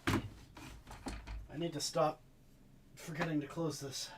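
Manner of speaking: high, soft, strained with pain. Real, not acted. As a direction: push words through the pain. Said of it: "I'm only playing this up slightly".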